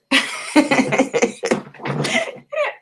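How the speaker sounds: embarrassed high laugh; sheepish high-pitched laughter. Real, not acted. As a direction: laugh off the botched name. Laughs after mangling a name.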